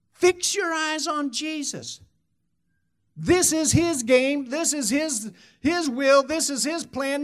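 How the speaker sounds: ringing sermon delivery, punching key words; impassioned preaching. Acted. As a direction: preach it with full force, pitched high.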